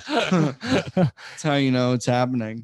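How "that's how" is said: slow, laughing through words. Real, not acted. Laughing during talk.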